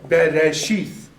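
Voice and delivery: BERESHITH slowly chunked as be-re-shith; slow syllable-by-syllable demo of BERESHITH; broken into syllables. Shown as a demonstration. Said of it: "how to break the word up".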